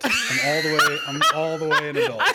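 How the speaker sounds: loud, laughing protest that she's an adult; playful laughing protest; laughing insistence. Real, not acted. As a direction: half-shout it through a laugh.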